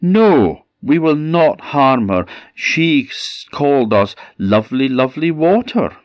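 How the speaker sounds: high, loud protective no; indignant refusal. A narrator's reading. River refusing the chest, defending the girl. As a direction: defend her with a lifted voice. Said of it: "But the river said".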